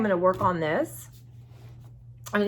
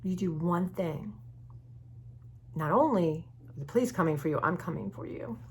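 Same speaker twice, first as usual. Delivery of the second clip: quiet retold threat; 8 dB quieter than usual. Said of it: "basically said".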